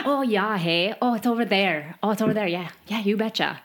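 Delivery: playful imitation of a thick Yooper accent with long vowels; lightly hamming up the Yooper accent. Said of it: "forgotten what a Yooper sounds like".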